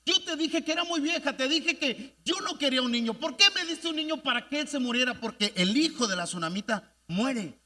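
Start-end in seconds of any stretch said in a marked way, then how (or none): 0.1-5.1 reproaching mother. Retold or imitated. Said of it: "empieza a reclamarle".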